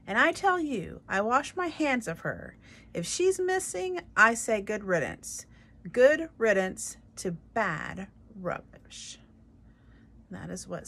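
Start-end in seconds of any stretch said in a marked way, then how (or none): 0.1-9.2 loud, high, scornful yell; angry shout. A narrator's reading. Luis shouting scornfully about the missing girl. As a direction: spit out good riddance.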